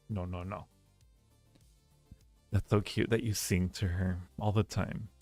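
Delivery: ordinary talk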